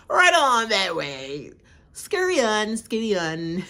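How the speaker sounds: slow, drawn-out sing-song goodbye; mocking farewell. Waving Chrishell off the show, slow and mocking. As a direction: wave her off slowly and mockingly.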